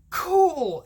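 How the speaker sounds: high, loud delighted exclamation; high-pitched thrilled reaction; excited. Retold or imitated. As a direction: burst out, impressed. Retells own delighted reaction.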